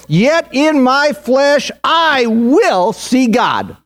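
high-pitched, fervent sermon declaration; impassioned preaching. Real, not acted. Preacher voicing Job's lasting legacy of faith, impassioned preaching. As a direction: proclaim it high, stretching the final words.

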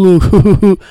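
high, loud put-on quote voice; mock quote voice. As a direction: bark it like a mocked character. Quoted voice opening the clip.

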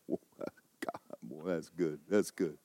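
soft breathy murmur of wonder; hushed amazement. Retold or imitated. Student murmuring awe at the preacher's grace sermon. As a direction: mutter it in awe to yourself. Imitating himself murmuring in school.

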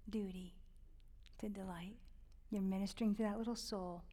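hushed whisper, barely voiced; a tender near-silent whisper. Real, not acted. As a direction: breathe the words out softly. Soft tender aside on duty.

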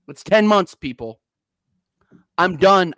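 normal talking voice

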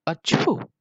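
loud, high acted "achoo"; mock sneeze. A narrator's reading. Monkey with a terrible cold, loud pretend sneeze. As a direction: sneeze it out with a burst on the second syllable. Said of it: "She had a terrible cold".

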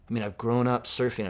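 ordinary talk

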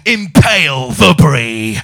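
slow war-cry brie. Pretend. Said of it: "really excel in a war".

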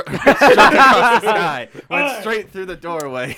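loud, high narration through laughter; helpless laughing delivery; cracking up. Real, not acted. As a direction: push the words out slowly while laughing. Narrates lightning while cracking up.

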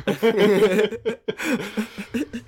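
silent wheezing giggle; breathless whispered laugh. Real, not acted. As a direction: laugh almost without voice. Cracking up at co-host.

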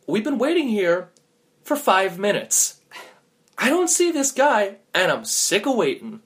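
high-pitched, exasperated griping; fed-up complaint. Acted. Friend griping about waiting at crossroads, exasperated. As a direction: push the voice up high in impatience.

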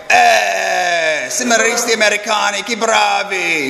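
high, effusive Italian greeting; suddenly nice priest. Retold or imitated. Priest delightedly greets American seminarians, hammed-up Italian welcome. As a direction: hail favourite guests, drawing out the last words. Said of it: "He's, like, the nicest guy".

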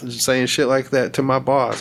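ordinary talk

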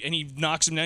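ordinary talk, nothing marked

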